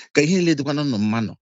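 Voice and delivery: ordinary talk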